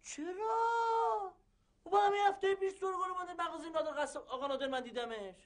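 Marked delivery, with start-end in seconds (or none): 0.0-3.7 stunned on hearing grandfather is dead; shocked. Acted. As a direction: gasp out the question in disbelief. Shocked at news of death.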